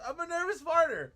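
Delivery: high, loud mock confession; hammed-up imitation of a student blurting it out; mimicked student. Student explaining herself to teacher, loudly mimicked.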